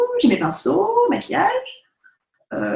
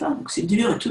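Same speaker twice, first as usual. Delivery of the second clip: ordinary delivery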